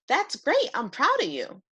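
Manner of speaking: put-on high, patient little boy voice; a kind boy calming his angry dragon; gentle boy. George praises Joe.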